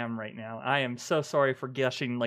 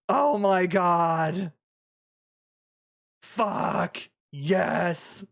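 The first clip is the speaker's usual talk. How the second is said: excited; 2 semitones higher, 3 dB louder, much slower than usual. Real, not acted. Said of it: "I am so fucking pumped!".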